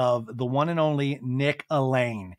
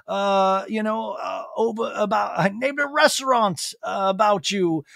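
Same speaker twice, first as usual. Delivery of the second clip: high-pitched, gushing put-on voice; mock adoration, high with halting fillers; Lisa Vanderpump; 5 semitones higher than usual. Lisa telling the wolf of her love, gushing impression. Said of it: "And Lisa's like".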